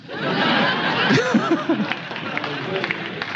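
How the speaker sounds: rising, high real laughter. Real, not acted. Speaker laughing at his own watch story, real laughter. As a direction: let a genuine laugh rise and climb.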